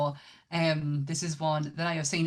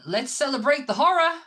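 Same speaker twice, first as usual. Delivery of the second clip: hammed-up excitement; 8 semitones higher, 6 dB louder than usual. Tagline read, big laugh follows.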